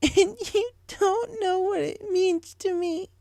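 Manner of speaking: very high, tearful pony voice; sobbing Pinky. A narrator's reading. Pinky confessing to Rainbow, tearful. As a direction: confess through tears, slowly.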